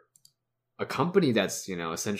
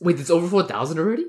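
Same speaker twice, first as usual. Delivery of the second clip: higher, louder, fast disbelief; surprised; 8 semitones higher, 7 dB louder, faster than usual. Real, not acted. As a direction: blurt it out in shock. Startled by sales news.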